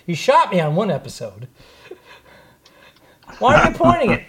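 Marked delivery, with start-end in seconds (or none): 3.4-4.3 higher, louder startled protest; alarmed. Real, not acted. Host startled when cohost aims prop gun, higher and louder. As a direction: jump at the gun pointed your way.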